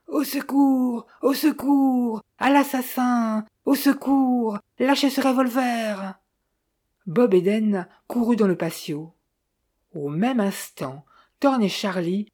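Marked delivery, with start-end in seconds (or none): 0.1-6.2 cri aigu et lent de perroquet, voix étrange; perroquet qui crie. A narrator's reading. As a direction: hurle comme un oiseau affolé, plus haut. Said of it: "une voix rauque et étrange". (perroquet hurlant au secours, voix étrange et aiguë)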